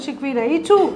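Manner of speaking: ordinary delivery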